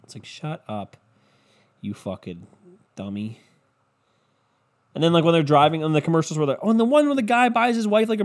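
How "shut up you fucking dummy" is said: low, quiet put-down; muttered scorn. Acted. Comic mutters at the wife in the car commercial, low and scornful. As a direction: drop low and soft, dismissive.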